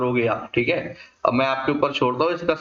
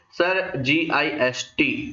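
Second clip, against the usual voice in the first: slow spelling out; much slower than usual. Shown as a demonstration. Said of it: "इसका हमें correct synonymous word बताना है".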